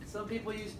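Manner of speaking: ordinary talk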